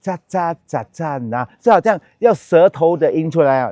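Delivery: ordinary delivery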